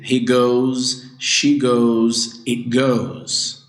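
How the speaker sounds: "goes" ending buzzed as a clear z sound. Shown as a demonstration. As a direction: stretch the z at the end of "goes". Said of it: "a terminação parece com som de Z".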